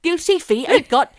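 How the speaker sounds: loud, high West Country burr, building. Acted. Host doing Careless Whisper as a soap farmer, West Country. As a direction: belt out lyrics in a hammed-up West Country accent. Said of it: "Eddie Grundy doing Careless Whisper".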